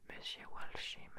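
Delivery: plain reading voice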